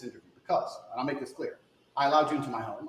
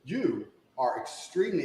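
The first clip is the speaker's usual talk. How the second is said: ordinary talk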